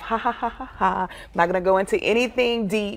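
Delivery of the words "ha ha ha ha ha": soft, deliberate ha-ha laugh; mock laugh. Shown as a demonstration. Telling how she'll laugh at the reunion, mock laughing. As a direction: fake a light, spaced-out laugh. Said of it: "I'm gonna laugh".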